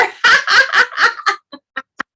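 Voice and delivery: high, loud burst of laughing; big forgetful laugh. Shown as a demonstration. Teacher leading the class in a forgetting laugh, loud laughing. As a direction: let the laugh explode. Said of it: "So we're gonna try".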